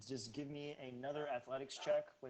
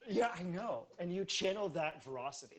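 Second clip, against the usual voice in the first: ordinary delivery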